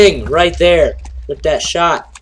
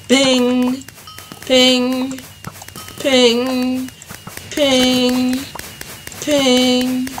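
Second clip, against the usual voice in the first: ping sound effect; 7 semitones higher, much slower than usual. Pretend. Mimicking arrow hit sound.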